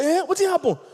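high, gentle put-on question; a friend checking in, pitched high; voicing a concerned friend. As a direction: ask like a worried friend. Pastor acts the caring friend.

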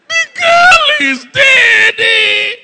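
long, high, loud wail; mock wailing. Mourner announcing a death, drawn-out mock wail. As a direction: stretch every word into a hammed-up cry. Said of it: "some people will start crying".

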